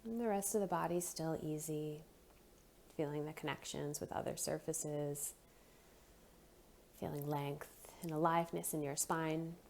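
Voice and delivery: soft, unhurried meditation voice with long pauses; guided breathing meditation. Real, not acted. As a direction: gently guide them into noticing the breath.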